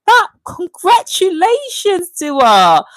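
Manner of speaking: high, stretched-out congratulations; delighted congratulations. Real, not acted. Streamer congratulates Dua on the baby, delighted. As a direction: draw the word out in high-pitched delight.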